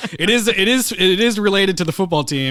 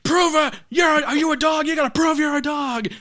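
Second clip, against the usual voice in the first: high, hammed-up coach yell; mock coach shout; 11 semitones higher than usual. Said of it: "The guy's like".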